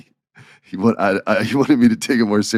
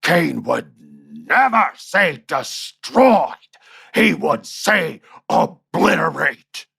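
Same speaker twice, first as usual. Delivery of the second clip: high, slow, drawn-out mock shout; slow, high angry boss impression; retold angry boss; 7 semitones higher, much slower than usual. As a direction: imitate the boss snapping at the writer. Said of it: "he sh-, shout, he goes".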